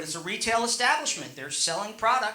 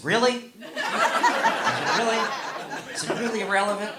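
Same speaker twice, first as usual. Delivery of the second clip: mock incredulous menu; 3 semitones higher than usual. Voicing the ad menu's reply.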